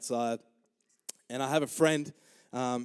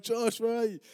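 high-pitched mock calling out; retold yelling; 9 semitones higher than usual. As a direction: imitate shouting for a missing brother. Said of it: "So I start yelling".

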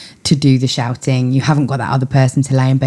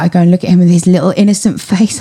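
ordinary talk, nothing marked

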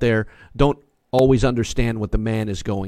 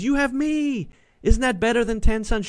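Elkanah's words; 9 semitones higher, 3 dB quieter than usual. Retold or imitated. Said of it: "Elkanah says".

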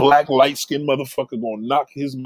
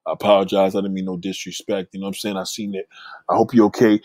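mock humble apology; 5 semitones lower than usual. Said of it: "You like".

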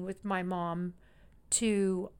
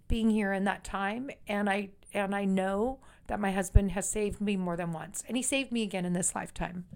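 ordinary talk, nothing marked

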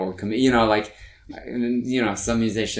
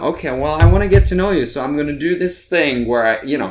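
higher, louder eager patter to a crowd; insecure stage patter; 6 semitones higher, 8 dB louder than usual. Retold or imitated. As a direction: mimic yourself awkwardly winning over an audience. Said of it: "I'm telling them".

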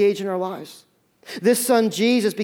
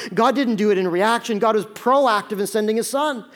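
ordinary talk, nothing marked

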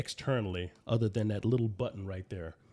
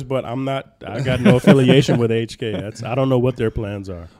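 yelling; 3 semitones higher, 10 dB louder than usual. Real, not acted. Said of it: "Why are you yelling at him?".

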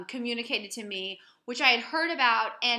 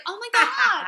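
high, louder startled "oh my God"; startled husband; 12 semitones higher, 8 dB louder than usual. Retold or imitated. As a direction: recoil in surprise. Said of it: "And he was like".

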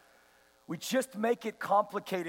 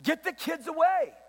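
raised pitch, brusque acted scolding; mock rebuke; 6 semitones higher than usual. Disciples turning children away from Jesus, mock rebuke. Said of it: "They rebuked them".

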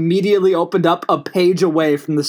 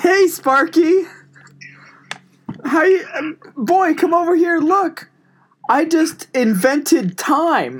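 talking to his dog; 7 semitones higher than usual. Acted. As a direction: sweet-talk the dog like a genius. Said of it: "world's smartest, talk to dog".

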